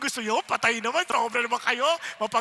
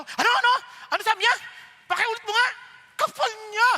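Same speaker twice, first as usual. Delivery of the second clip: much higher, louder, offended demand; indignant outburst; 11 semitones higher, 9 dB louder, faster than usual. Acted. Churchgoer reacting angrily to gossip, loud outrage. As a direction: snap back, offended by the gossip.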